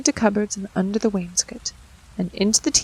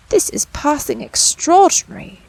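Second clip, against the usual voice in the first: put-on higher, louder amazed old tailor; astonished tailor; 6 semitones higher, 5 dB louder than usual. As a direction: marvel aloud at the mice. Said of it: "said the Tailor of Gloucester".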